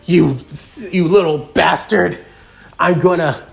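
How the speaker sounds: acted pained, angry sputter; pained anger. A narrator's reading. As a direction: spit the insult out with a touch of pain. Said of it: "couldn't help but cough in that of pain".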